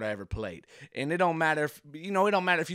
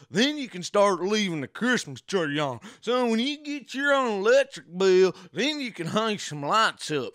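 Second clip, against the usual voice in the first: higher, louder fatherly lecture; dad lecturing; 7 semitones higher, 4 dB louder than usual. Retold or imitated. Kid imitating his dad about the electric bill, stern. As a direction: lay down the law like a stern country dad. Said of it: "my dad would always say".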